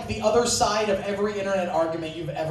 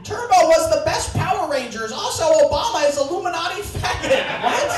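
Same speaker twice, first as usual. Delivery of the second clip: mock internet troll; 6 semitones higher, 3 dB louder than usual. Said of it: "YouTube comment arguing".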